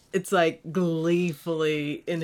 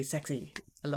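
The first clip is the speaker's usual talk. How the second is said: much softer, dreamy sigh of a word; hushed swoon; 4 semitones lower, 11 dB quieter, much faster than usual. Real, not acted. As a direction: breathe it out, swooning. Swooning over Vader's voice.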